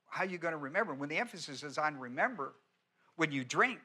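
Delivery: usual speaking voice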